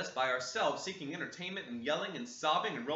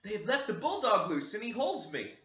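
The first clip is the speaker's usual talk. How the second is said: urgent plea; 8 semitones higher, 4 dB louder than usual. Acted. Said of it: "she whispered".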